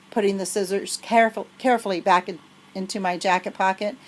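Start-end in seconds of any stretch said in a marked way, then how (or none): none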